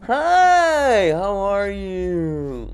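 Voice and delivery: high, slow sing-song hi; drawn-out sweet greeting; mock greeting. Cohost answering the mock welcome, slow high sing-song.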